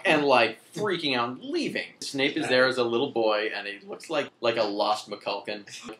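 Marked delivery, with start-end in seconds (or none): none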